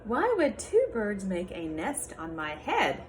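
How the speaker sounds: lively picture-book read-aloud with character voices; reading to children. Acted. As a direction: read it to a class of little kids.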